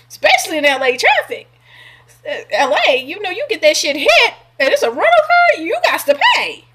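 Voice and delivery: high, loud, incredulous mock rant at the ex. Retelling scoffing at her ex's rental car, high and loud.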